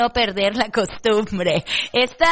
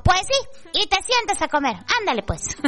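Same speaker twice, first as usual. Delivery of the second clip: bossy mom voice; 10 semitones higher than usual. Acted. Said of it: "acted mother insists".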